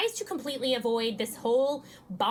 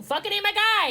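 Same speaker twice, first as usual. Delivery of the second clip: higher and louder, blunt dismissal; mock tough-guy quote; 10 semitones higher, 6 dB louder than usual. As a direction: toss it off like a swaggering bro. Quoting Joe's blunt dismissal.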